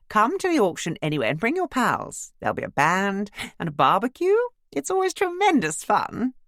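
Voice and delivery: cheerful well-to-do lady voice; bright posh neighbour. Acted. Imogen's voice.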